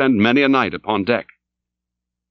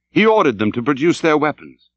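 ordinary delivery, nothing marked

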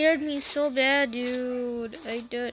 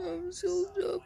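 hammed-up fake sob, higher; mock crying; 4 semitones higher, 4 dB quieter than usual. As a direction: whimper it like a pretend cry. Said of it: "You can see I'm crying".

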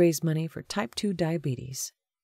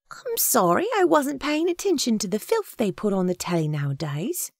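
put-on high-pitched flustered older-sister scold in character; hurried excuse in a high character voice; flustered Agatha; 6 semitones higher than usual. A narrator's reading. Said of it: "she said quickly".

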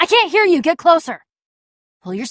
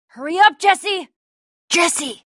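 urgent yell across a distance; urgent calling out; 4 semitones higher, slower than usual. Acted. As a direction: call a companion to hurry. Calling to companion in danger.

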